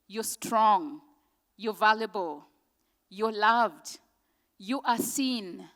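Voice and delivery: measured, earnest spoken-word reading; poem recitation. Acted. Poet reciting affirmations to an audience, earnest. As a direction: recite each line like a promise to the listener.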